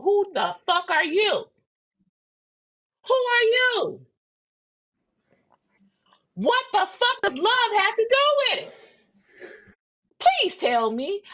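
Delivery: high, loud, incredulous yelling at him; outraged disbelief. Retold or imitated. Woman yells at her ex who begs to reunite, outraged. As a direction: shout it back at the man begging to return. Furious retort to ex's plea.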